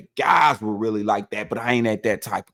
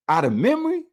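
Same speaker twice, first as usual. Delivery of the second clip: higher, amazed question; impressed warden; 12 semitones higher, faster than usual. Retold or imitated. Warden asks if it was drawn from memory, amazed. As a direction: lift it up in wonder.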